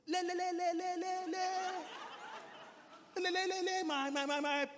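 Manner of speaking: loud, high stammer on repeated syllables; stammering Moses. Acted. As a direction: get stuck on each word, then force it out loudly. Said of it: "Moses was a stammerer".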